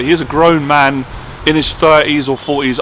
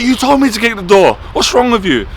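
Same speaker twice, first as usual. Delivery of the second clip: indignant retort; 7 semitones higher than usual. Retold or imitated. Retorting to the boss.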